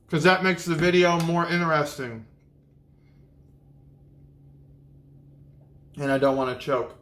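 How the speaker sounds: talking with a mouth full of sandwich; chewing while speaking. Real, not acted. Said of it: "we talk with our mouth full".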